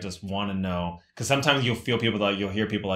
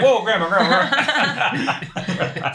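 high, loud mock alarm through laughter; alarmed onlookers; 11 semitones higher, 7 dB louder, much slower than usual. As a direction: laughingly imitate people trying to stop grandma. Bystanders alarmed at grandma's shout.

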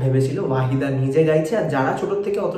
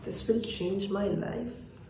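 very slow, soft, higher-pitched musing; hushed echo; 5 semitones higher, 10 dB quieter, much slower than usual. Acted. Narrator echoing the girl's promise to himself, hushed and slow. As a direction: let it drift out slowly, barely voiced.

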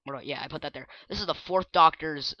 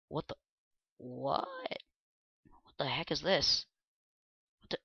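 unvoiced puzzled whisper; 9 dB quieter than usual. Real, not acted. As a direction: hush it in confusion. Confused whisper at new item.

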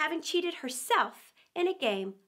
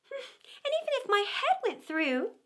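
put-on higher, childlike musing; Alice's voice; 9 semitones higher than usual. A narrator's reading. Alice wondering aloud to herself, higher and childlike. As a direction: voice a fretting little girl. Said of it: "thought poor Alice".

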